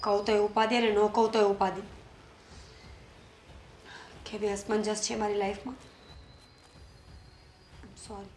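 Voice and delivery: soft, low apology to herself; quiet remorse. Real, not acted. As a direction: murmur your regret alone. Alone, regretting the quarrel. (ધીમા અવાજે પસ્તાવો)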